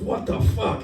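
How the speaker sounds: high, loud disbelieving what the fuck; shocked outburst. Retold or imitated. Cop seeing driver's bare backside, shocked outburst. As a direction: blurt it out, stunned.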